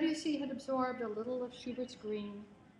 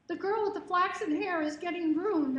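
loud, high, tearful wail; sobbing; 8 semitones higher, 9 dB louder than usual. Retold or imitated. Girl sobbing to a stranger through his car window, loud tearful wail. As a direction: cry it out high and loud over the rain. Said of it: "I sobbed across to him".

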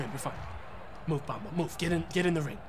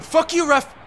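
angry yell at ref; 7 semitones higher, 3 dB louder than usual. Real, not acted. Yelling at the game referee.

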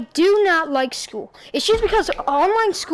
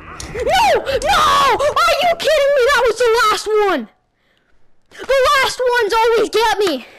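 high, loud wail of disbelief; frustrated outcry; 7 semitones higher, 5 dB louder than usual. Real, not acted. Gamer who just lost to the last opponent, frustrated outcry. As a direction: cry out in real outrage, pitch leaping up.

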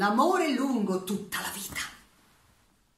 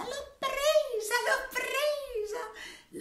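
very high, slow triumphant squeal; gleeful cry; much slower than usual. Acted. Teresa squealing as she catches the butterfly, very high and slow. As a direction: shriek it out like a delighted little girl. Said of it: "gridava distesa".

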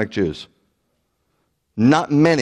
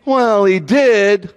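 drawn out and higher, mock concession; grudging concession; 9 semitones higher, 5 dB louder, much slower than usual. Preacher mimicking pastors who can't refute it, mock grudging concession. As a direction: drag out a reluctant admission, mimicking them. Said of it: "what do they gotta do?".

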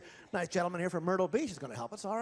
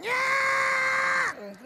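very high, loud squealing yell; a high, loud dummy cheer; ventriloquist dummy voice; 7 dB louder than usual. Acted. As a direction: shriek it like a squeaky puppet. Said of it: "no, I do the voice".

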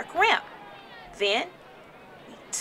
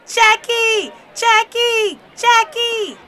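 high, loud cheering chant; crowd's excited yell; 13 semitones higher, 9 dB louder, slower than usual. A narrator's reading. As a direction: cheer the runner home at full voice. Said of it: "We all jumped to our feet yelling".

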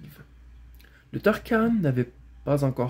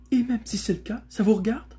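high, indignant retort; savage growl; 8 semitones higher than usual. A narrator's reading. Strange rider rebuffing Bré, fiercely defensive. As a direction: snap back in a high voice. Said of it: "gronda sauvagement l'étrange cavalier".

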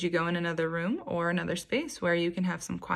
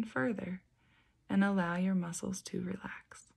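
guided meditation. Acted. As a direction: gently guide them into calm, unhurried and even. Leads a guided beach meditation.

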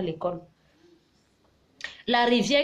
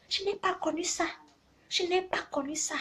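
high, much softer repeated regret; wistful; 9 semitones higher, 7 dB quieter than usual. Real, not acted. Telling viewers what she never had, soft and wistful. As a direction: let it fall away softly, pitched up.

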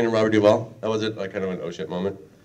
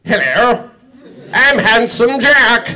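higher, louder, slow grand hammed-up voice; mock pompous hero intro; joking how Handsome Jack could have sounded; regal villain voice; 12 semitones higher, 9 dB louder, much slower than usual. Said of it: "some kind of".

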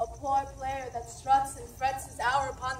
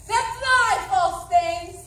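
high, loud commanding shout; furious shout; 7 semitones higher, 13 dB louder, much slower than usual. Acted. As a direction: hurl it at the servant. Macbeth raging at his servant.

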